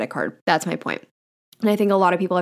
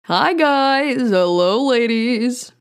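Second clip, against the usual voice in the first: higher, louder crooner greeting; 5 semitones higher, 3 dB louder, much slower than usual. Pretend. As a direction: swing into the hello like a lounge crooner. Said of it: "that's how I feel like I start out every single podcast episode".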